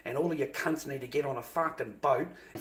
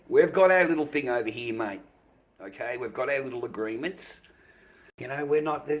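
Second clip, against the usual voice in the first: usual speaking voice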